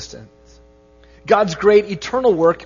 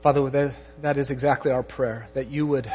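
soft, hushed prayer to God; 6 semitones lower, 7 dB quieter than usual. Real, not acted. Pastor praying over his congregation, soft and earnest. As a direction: pray quietly with the congregation, gently.

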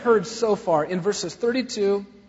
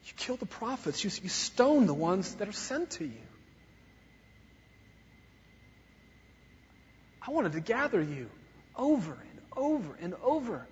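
tender lament; 4 semitones higher than usual. Acted. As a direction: grieve over a stubborn city. Voicing Jesus' tender lament.

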